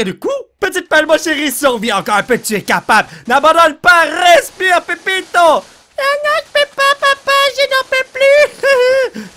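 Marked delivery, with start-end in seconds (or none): 0.6-5.6 loud pep talk. Acted. Encouraging the shovel like a coach. 6.0-9.3 very high, strained squeaky voice; shovel voice. Pretend. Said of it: "je suis en train d'imiter la voix de la pelle imaginaire".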